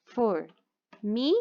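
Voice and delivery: formiga slowly split as for, mi, ga; syllable by syllable. Shown as a demonstration. Teacher dictating what to write, formiga in slow separate syllables. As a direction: leave long pauses inside formiga after for and mi. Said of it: "Aí, você coloca lá".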